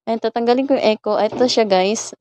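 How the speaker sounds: ordinary talk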